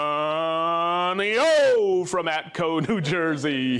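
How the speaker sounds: slow, booming stretched-out announcer call; monster truck announcer voice. Acted. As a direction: hype it like a monster truck rally. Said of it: "I'm working on that, uh, monster truck, uh, voice".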